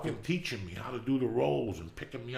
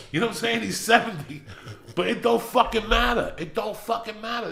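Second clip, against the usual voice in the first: passionate outburst; 12 semitones higher, 9 dB louder than usual. Real, not acted. Passionate rant about seventy-year-old.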